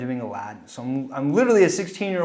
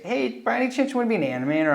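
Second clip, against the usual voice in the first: wedding guest; 9 semitones higher, faster than usual. Retold or imitated. Wedding guest asks him eagerly.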